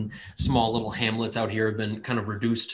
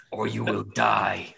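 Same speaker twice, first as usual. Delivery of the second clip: cold rasping evil emperor; 2 semitones lower, slower than usual. Acted. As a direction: menace him slowly like a dark deity. Deity threat.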